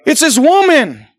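high, loud mock whine of a guilty man; Adam shifting blame. Adam blaming the woman before God, mock whine. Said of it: "He said".

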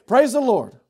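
loud, high, bold praise; unashamed exclamation. Acted. Believer praising God openly among coworkers, bold and unashamed. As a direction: proclaim it boldly for all to hear. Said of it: "Don't be ashamed or embarrassed to say".

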